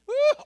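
high, loud "woo"; piercing preacher's whoop; exultant whoop. Real, not acted. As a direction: burst out with a whoop. Whoops before repeating verse.

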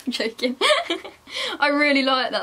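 giggling while speaking; laughing. Real, not acted. Laughing at joke.

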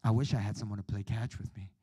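inner longing. Retold or imitated. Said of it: "deep down inside".

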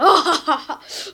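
loud open-mouthed laugh; demo laugh. Shown as a demonstration. Vlogger demonstrating his real laugh to critics, loud. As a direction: let out a full laugh to show it. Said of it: "Ya öyle gülüyorum".

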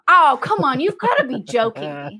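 high, loud burst of disbelief; exasperated disbelief. Real, not acted. Co-reader objecting to boiled something, exasperated. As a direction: groan it out, fed up.

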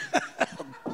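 a sudden squeaky giggle at his own joke; high-pitched burst of laughter. Real, not acted. Preacher cracking up at his own toilet joke, high giggle. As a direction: break into a high cackle of laughter.

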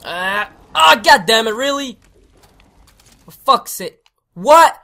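loud exasperated rant; frustrated. Real, not acted. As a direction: vent it loudly. Teammate left, fuming.

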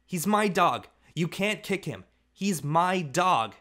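put-on little boy yelling at his brother to stop; child's yell. A narrator's reading. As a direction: yell it clinging to the dog. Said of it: "He began to yell".

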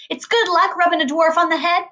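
lightly voiced pushy Loretta; a hint of a brash woman teasing; Loretta's voice. A narrator's reading. Loretta voiced in read story.

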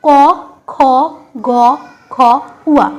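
ক, খ, গ, ঘ, উয়া each sounded alone, with a pause between; letter-by-letter pronunciation. Shown as a demonstration. Teacher showing students how throat letters are pronounced, sounded one by one. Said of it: "চলো আমরা উচ্চারণ করি".

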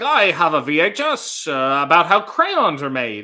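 loud, eager delivery-man voice; Mister McFeely. Retold or imitated. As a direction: announce the VHS like an eager mailman. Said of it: "Mister McFeely be like".

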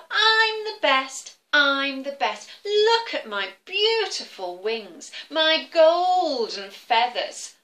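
high, loud, slow bragging boast; chanting I'm the best, proud and slow; boastful eagle. Acted. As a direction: crow it out like a show-off eagle. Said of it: "Can you do that with me?".

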